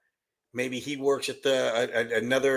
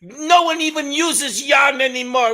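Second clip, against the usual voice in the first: high, loud nagging voice; nagging wife; 10 semitones higher, 6 dB louder, slower than usual. Retold or imitated. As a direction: scold him in a high, loud voice. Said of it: "she said".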